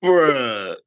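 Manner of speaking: ordinary talk, nothing marked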